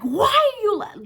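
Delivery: high, urgent scolding, cut short; acted alarm. Teller pulling her friend away, alarmed scolding. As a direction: demand it in high-pitched disbelief.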